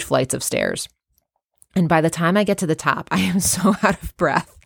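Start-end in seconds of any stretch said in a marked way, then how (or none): 3.1-4.6 amused, laughing through the words. Real, not acted. Telling listeners about climbing stairs at work, laughing. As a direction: admit it with a laugh breaking in.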